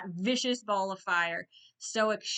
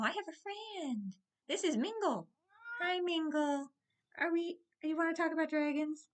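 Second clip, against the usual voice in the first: high, soft cooing; pet-talk voice; 8 semitones higher, 4 dB quieter than usual. Real, not acted. Vlogger introducing Mingle to viewers, high soft pet talk.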